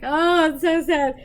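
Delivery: hammed-up tearful outburst; mock bawling. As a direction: wail it in mock sorrow. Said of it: "I was bawling".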